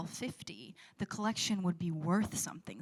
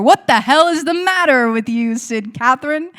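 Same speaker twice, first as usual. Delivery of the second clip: loud, high outburst; angry scolding; 5 semitones higher, 19 dB louder than usual. Acted. Aunt to niece who broke the china, angry scolding. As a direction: explode at the culprit at full volume.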